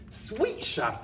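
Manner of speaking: very high, loud shrill cry; squealing witch chorus. Acted. Witches answering their leader, very high and loud. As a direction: squeal eager agreement. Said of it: "they cried".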